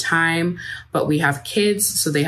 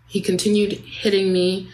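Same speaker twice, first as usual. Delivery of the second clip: ordinary delivery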